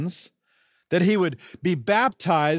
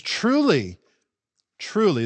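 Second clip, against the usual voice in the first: each "Truly" hit firmly and falling; emphatic; 4 semitones higher than usual. Acted. Preacher quotes Jesus addressing the scribes, emphatic. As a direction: say "Truly" twice, each one a weighty declaration. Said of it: "this is a word that emphatically says".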